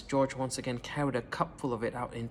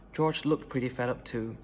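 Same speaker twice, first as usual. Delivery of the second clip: usual speaking voice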